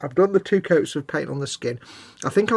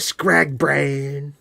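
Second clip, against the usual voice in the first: drawn-out name; 4 dB louder than usual. Pretend.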